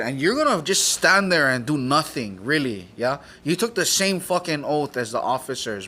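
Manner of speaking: loud, heated retold dressing-down; forceful, outraged challenge; indignant scolding. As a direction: berate the sheriff with punched disbelief.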